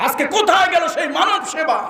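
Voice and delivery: very high, ringing cry; impassioned calling out. Real, not acted. Preacher challenging the Muslims before him, impassioned high cry. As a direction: cry the challenge out to the whole crowd.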